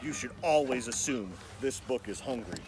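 soft, secretive storyteller warning; hushed warning. A narrator's reading. Narrator quietly warning young listeners of danger. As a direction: lean in and warn the reader in a hush.

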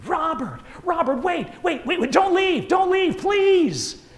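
high, loud, desperate calls of a name; frantic calling. Retold or imitated. Stewardess running down the hallway, frantic high cries. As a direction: shout after him, panicking he'll go. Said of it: "calling my name frantically".

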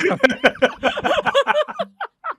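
a very high, squealing burst of laughter; shrieking laugh. Real, not acted. Laughs at friend knowing the game. (หัวเราะกรี๊ดเสียงสูงมาก)

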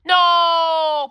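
high, loud scream of No; panicked scream. Acted. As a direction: shriek it in panic. Joker begs not be told.

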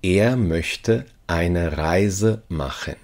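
ordinary talk

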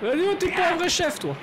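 taunting jeer. Real, not acted. Taunting an enemy in combat.

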